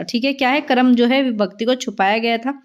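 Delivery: normal talking voice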